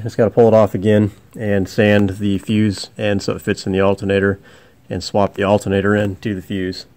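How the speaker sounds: usual speaking voice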